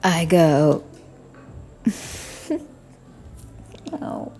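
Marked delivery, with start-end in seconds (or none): none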